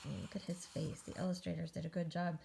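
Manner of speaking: usual speaking voice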